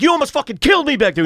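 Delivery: fast, high-pitched furious accusation; retold yelling. Accusing the driver of nearly killing him, retold yelling. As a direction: re-enact an outraged outburst at speed. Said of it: "And I'm yelling".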